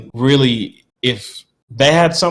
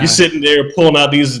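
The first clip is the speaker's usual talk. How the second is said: ordinary talk, nothing marked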